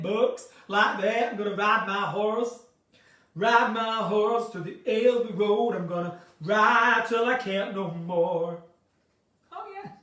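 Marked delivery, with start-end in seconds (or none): 0.0-1.3 drawn-out southern American vowel; southern American accent. Shown as a demonstration. Showing how she gets into it, southern American accent. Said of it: "how I get into my South American accent". 1.3-8.6 southern American accent. Shown as a demonstration. Performing a cowboy riding his horse, southern American accent. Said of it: "get into my South American accent".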